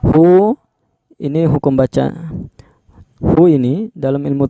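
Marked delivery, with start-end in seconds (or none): 0.0-0.6 "Hu" with the u held, higher; raised, sustained "Hu"; two-count long vowel. Shown as a demonstration. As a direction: lengthen "Hu" for two beats. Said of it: "hu juga ini panjang dua harokat".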